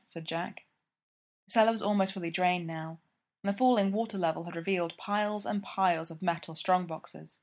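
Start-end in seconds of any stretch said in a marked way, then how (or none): none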